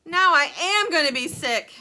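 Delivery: high, loud nauseous wail; queasy woman yelling; sick yell. A narrator's reading. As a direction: yell it about to throw up. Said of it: "yelled Missus T V".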